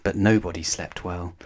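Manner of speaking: plain reading voice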